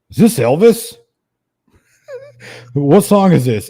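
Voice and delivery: imitating a drunk buddy baffled by the song; drunk friend. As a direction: play the tipsy guy squinting at the screen. Drunk friend reads karaoke screen.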